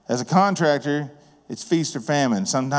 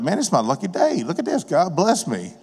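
lightly voiced greedy bystander, pitched higher; mock a delighted person pocketing the extra cash; gleeful lucky-day quote; 5 semitones higher than usual. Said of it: "Most people are like".

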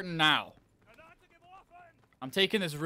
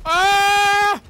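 sudden high, loud scream of shock; startled scream; 18 dB louder than usual. Real, not acted. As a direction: yelp out in real surprise. Sudden kill in game.

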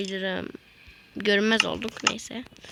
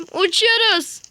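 excited cry; 9 semitones higher, 6 dB louder, slower than usual. Real, not acted. Mount flies up suddenly.

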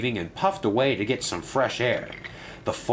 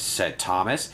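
ordinary delivery

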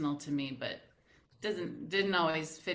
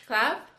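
sharp count word; called-out clap; 5 semitones higher, 6 dB louder than usual. Shown as a demonstration. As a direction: clap punched, loud and high. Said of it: "So we go".

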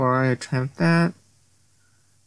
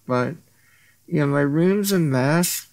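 ordinary talk

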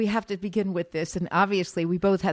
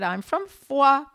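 put-on slow Southern French accent, Foix drawn out; 6 semitones higher, 4 dB louder, slower than usual. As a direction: quote the student in his Southern French accent. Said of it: "And he said".

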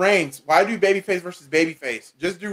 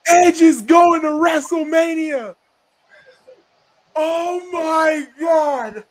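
excited shout; 8 semitones higher, slower than usual. Real, not acted. Live reaction to Rumble win.

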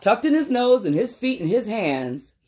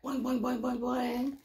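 light bouncy boing sounds, higher and softer; boing hops; 6 semitones higher, 11 dB quieter than usual. Acted. Reading to kids, voicing the hops playfully. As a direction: bounce each boing like a hop.

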